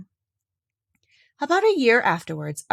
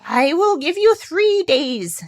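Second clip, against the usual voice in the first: high, slow, louder impish voice; little man voice; 8 semitones higher, 5 dB louder, slower than usual. Acted. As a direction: dangle the offer slyly. Little man's offer.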